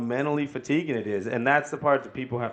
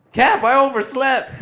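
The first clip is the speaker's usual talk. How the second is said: panicked; 9 dB louder than usual. Retold or imitated. Recruit phoning his captain, imitated in high slow panic.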